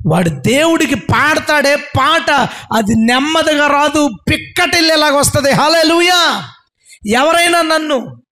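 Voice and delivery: high, stretched, emphatic delivery; impassioned preaching. Real, not acted. Preacher insisting praise cannot come out gently, impassioned preaching. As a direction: build to a ringing hallelujah.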